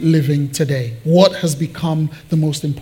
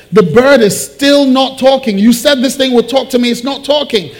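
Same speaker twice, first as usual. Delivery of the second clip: higher, louder indignant customer; exasperated complaint; 7 semitones higher, 5 dB louder than usual. Acted. Customer back at the pet shop, exasperated. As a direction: complain with rising exasperation, punching the words.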